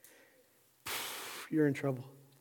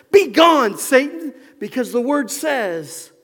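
loud, raised preacher's voice; impassioned, emphatic sermon delivery; forceful preaching; 5 semitones higher, 7 dB louder than usual. Real, not acted. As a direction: drive each phrase home to the congregation.

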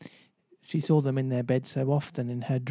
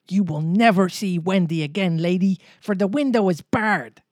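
higher and louder, gloating in triumph; exultant cry; 6 semitones higher, 7 dB louder than usual. Acted. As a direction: crow it out, flushed with victory. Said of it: "he cried exultantly".

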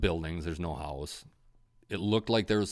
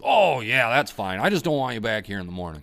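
louder, higher, fast by the end; imitating the neighbor; 5 semitones higher, 8 dB louder than usual. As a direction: rattle off the landowner's terms. Quoting the neighbor.

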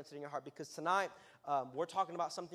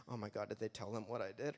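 nervous whisper-low listener voice; hushed worried aside from a guilty listener; mock panic; 7 semitones lower, 4 dB quieter than usual. Guilty youth listener fearing exposure, low worried mutter. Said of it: "you're like".

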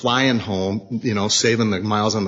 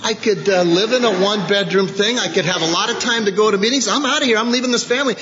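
higher, mock-defiant selfish rant; selfish inner voice; 7 semitones higher than usual. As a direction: sneer at the family in your own head. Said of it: "I start thinking in my mind".

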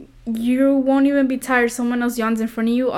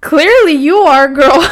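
loud, slow teasing jab ending in a laugh; 5 semitones higher, 9 dB louder, slower than usual. Real, not acted. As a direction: call her out loudly.

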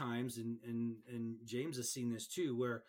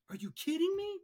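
much higher exasperated cry; mock disbelief; 4 dB louder than usual. Referee to varsity player, higher, mock exasperation. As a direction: throw up hands at the player. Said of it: "I'm like".

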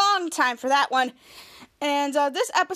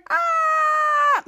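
long, very high mock scream; scared scream; 12 semitones higher, 3 dB louder than usual. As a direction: imitate a group shrieking in fright. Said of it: "all of them being scared".

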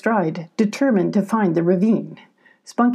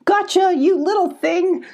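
high-pitched playful pounce call; gleeful call; 11 semitones higher than usual. A narrator's reading. Abby pounces on her muddy friend, gleeful call. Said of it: "called Abby, laughing".

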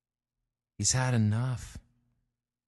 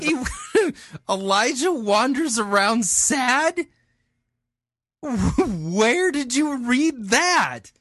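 very high, loud, incredulous laughter; slow, drawn-out question breaking with laughs; laughing disbelief; 6 dB louder, slower than usual. Real, not acted. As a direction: squeal it through real laughter.

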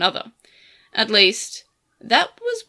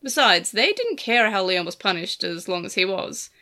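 ordinary delivery, nothing marked

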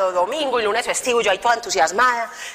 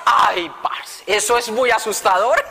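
startled exclamation; slower than usual. Real, not acted. Startled at God answering thoughts.

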